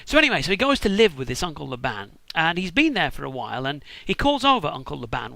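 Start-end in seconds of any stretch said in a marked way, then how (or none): none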